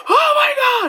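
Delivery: high frightened shriek; panicked screaming. Retold or imitated. Navigator with hand on the dash screams in fright. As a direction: cry out in terror. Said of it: "Just screaming".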